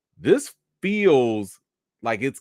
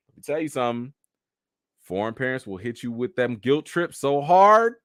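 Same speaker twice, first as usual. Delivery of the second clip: ordinary delivery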